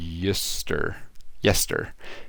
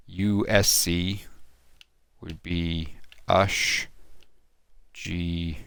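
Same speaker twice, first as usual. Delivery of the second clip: normal talking voice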